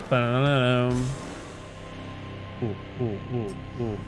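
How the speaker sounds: ordinary talk, nothing marked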